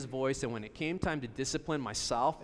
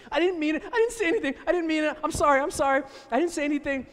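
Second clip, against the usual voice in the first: put-on much higher and louder, a frightened child backpedaling; panicked pleading; 10 semitones higher, 7 dB louder, faster than usual. A scared son begs his angry dad, high panicked pleading. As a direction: beg fast like a scared kid caught out.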